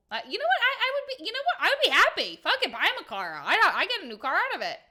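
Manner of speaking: high, loud and giddy; excited high voice. Pretend. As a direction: squeal it out like you just won a car. Imagined giddy reaction to car.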